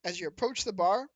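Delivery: ordinary talk, nothing marked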